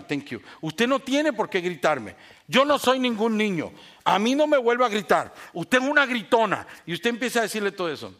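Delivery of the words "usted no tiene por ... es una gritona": loud, raised-pitch accusation; acted angry scolding. Husband accusing wife of yelling, acted angry rebuke. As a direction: build into a hammed-up telling-off. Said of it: "estoy súper enojado porque usted me gritó".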